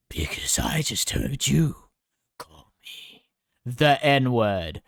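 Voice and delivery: lightly voiced menacing bully, slowed down; a hint of a bully voice, slow; mock gruff Yard. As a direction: drag out the accusation, hammed-up. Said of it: "Yard voiced accusation".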